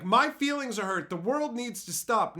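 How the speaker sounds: high, loud mock grievance; mock complaint. Offended person demands the world stop, mock aggrieved. Mocks people with hurt feelings.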